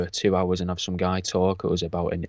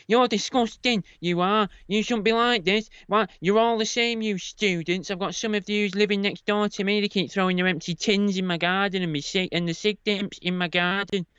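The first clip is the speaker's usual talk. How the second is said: put-on high, scolding old woman; imitating an angry old neighbour, high pitched; shrill old woman voice; 10 semitones higher than usual. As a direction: put on a much higher, nagging old lady voice. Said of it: "she went".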